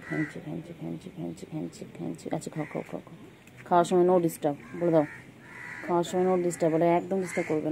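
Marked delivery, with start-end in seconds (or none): 0.1-3.1 quiet cooing to a cat; soft nonsense coo. Real, not acted. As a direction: murmur the pet sounds much softer. (খুব নরম আদুরে ফিসফিসে ডাক)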